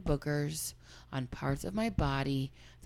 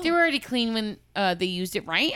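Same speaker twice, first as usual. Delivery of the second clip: normal talking voice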